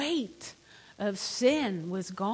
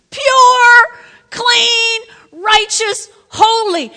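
much higher and louder, tearful cry; impassioned cry; 11 semitones higher, 15 dB louder, much slower than usual. Real, not acted. Preacher declaring how Jesus sees sinners, loud tearful. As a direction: cry it out with full passion.

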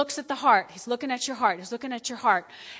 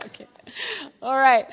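ordinary talk

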